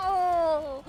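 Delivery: high laughing, near tears. Real, not acted. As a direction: laugh till it cracks. Crying with laughter.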